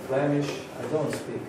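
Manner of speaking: normal talking voice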